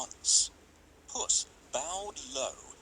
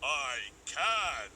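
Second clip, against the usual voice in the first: ogre; 3 semitones higher than usual. A narrator's reading. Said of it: "said the ogre".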